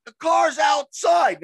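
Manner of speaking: drawn-out shouted protest; retold bewildered shout; slow high yell. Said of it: "I'm like".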